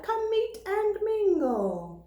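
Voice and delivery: slow, drawn-out crow call. A narrator's reading. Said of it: "Crow calls out".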